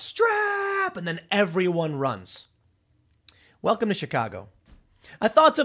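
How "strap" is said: high, drawn-out mock shout; sudden high-pitched holler, acted out; imitated warning yell. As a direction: yell it like a bystander warning the crowd. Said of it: "and then someone yells".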